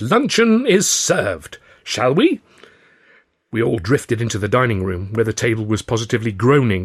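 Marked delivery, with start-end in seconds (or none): 0.0-2.4 higher, slow and louder, like a showman host; grand announcement. A narrator's reading. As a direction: announce lunch with a flourish. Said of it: "our host cried".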